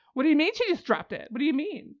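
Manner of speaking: high, disbelieving exasperation; incredulous protest. Real, not acted. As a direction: object as if personally cheated. Exasperated at dropped plot thread.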